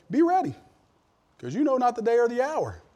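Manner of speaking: "ready" flung up high, then a loud proclamation; preacher proclaiming Jesus' warning to the church; ringing scripture quote. Acted. Quoting Jesus with emphasis.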